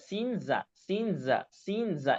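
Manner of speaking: repeated সিন যা, with যা made at the tongue tip like সিন; teacher modelling the Arabic za for the class; Arabic za drill. Shown as a demonstration. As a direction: say সিন যা with যা from the same spot as সিন. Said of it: "ঠিক এখান থেকে যা বলেন".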